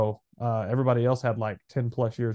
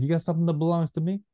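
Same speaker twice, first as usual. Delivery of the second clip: quick, higher Italian-American mobster impression; Italian mobster accent; 4 semitones higher, faster than usual. Joking about accent types, Italian mobster accent. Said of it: "it's like Italian mobster accent".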